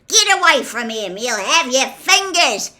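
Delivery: put-on high, loud rough-accented shout; urgent holler to back off; yelled warning. A narrator's reading. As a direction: bellow a warning at them. Gruff giant yells a warning.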